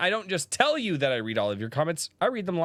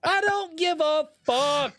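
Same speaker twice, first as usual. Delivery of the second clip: much higher and slow, fed up; pitched way up and slowed; slow exasperated high outburst; 13 semitones higher, slower than usual. Real, not acted.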